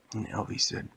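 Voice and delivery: plain reading voice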